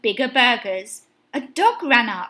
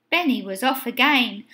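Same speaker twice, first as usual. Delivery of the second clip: usual speaking voice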